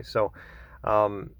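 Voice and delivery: ordinary talk, nothing marked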